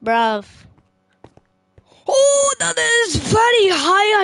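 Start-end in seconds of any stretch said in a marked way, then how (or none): 2.1-4.2 high-pitched frightened wail, slow; scared cry. Real, not acted. Gamer staring down a huge drop, frightened. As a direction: whimper it out in real fear.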